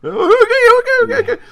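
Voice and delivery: very high, jittery okays; flustered relief. Acted. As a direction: squeak out nervous agreement.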